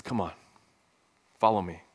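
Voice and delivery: low, soft call to follow; gentle invitation. Pretend. Jesus calling the one who cries Lord, low and soft. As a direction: invite softly, almost hushed. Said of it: "he says".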